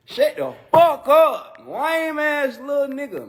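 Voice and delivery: mock [name] rant. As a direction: play the rapper telling him off. Mocking [name] insulting him.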